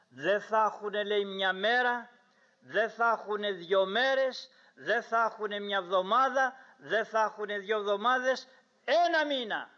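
rising list to a high peak; mounting amazement. Retold or imitated. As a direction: build each step, topping out on the last. Climbing list up to a month.

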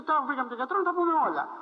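put-on high, loud eager old man finally at the doctor; eager old patient. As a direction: voice the old man piling up his complaints. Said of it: "Σου λέει".

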